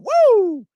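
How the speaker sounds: high, loud woo; elated whoop. Real, not acted. Streamer on fire with donations, elated whoop. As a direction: let out a piercing celebratory whoop.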